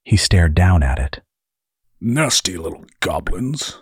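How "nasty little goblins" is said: a touch of disgust in a character voice; lightly voiced distaste; mildly repelled, playing a character. A narrator's reading. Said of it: "disgust evident in his voice".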